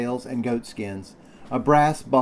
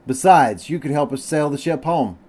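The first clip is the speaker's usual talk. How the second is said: higher, louder assurance; eager cry; 5 semitones higher, 4 dB louder than usual. A narrator's reading. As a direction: press the promise keenly, pitch up. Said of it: "I cried".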